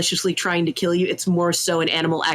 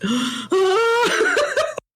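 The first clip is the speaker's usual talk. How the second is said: big high gasp breaking into a laugh. Retold or imitated. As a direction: gasp in delighted shock. Curse lifted just in time.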